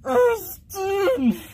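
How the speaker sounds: high, loud tearful cry of a name; distressed wail. Acted. Calling a friend in trouble, tearful cry.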